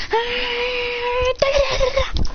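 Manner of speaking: high ringing yell into rapid das; battle cry. Acted. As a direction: charge in with a chant.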